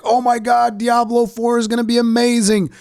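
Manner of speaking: high, squealing excited fanboy voice; mocking hype. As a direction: gush like a hyped fan. Mocking hyped fans.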